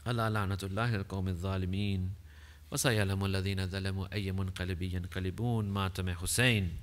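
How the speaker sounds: preacher's fast, low Arabic closing formula; fast low recitation. Acted. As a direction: rattle off the ritual formula quickly and low.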